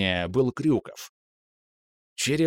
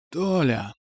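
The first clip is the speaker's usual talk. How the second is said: hoarse voice; 4 semitones higher than usual. A narrator's reading. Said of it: "сиплым голосом сказал Захар".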